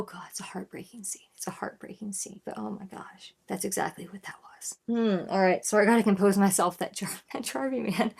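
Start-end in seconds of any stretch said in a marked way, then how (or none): 0.0-4.8 whispered, pained aside; hushed. Real, not acted. Fan recalling a heartbreaking scene, hushed. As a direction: drop to a soft whisper.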